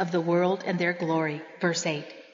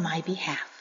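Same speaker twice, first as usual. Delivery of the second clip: whispered; 5 semitones lower, 6 dB quieter than usual. Acted. Preacher ending her surgery story, whispering the promise. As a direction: breathe the last words as a hushed whisper.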